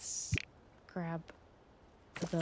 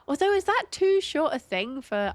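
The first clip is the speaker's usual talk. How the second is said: sudden worry; 7 semitones higher, 5 dB louder than usual. Real, not acted. Sudden doubt about track length.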